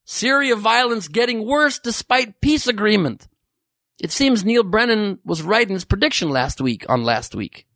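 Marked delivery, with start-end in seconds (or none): none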